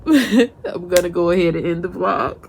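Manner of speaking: laughing through the words, a bit teary. Real, not acted. Tipsy mom ending her vlog, laughing through tears. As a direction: laugh it off and wrap up.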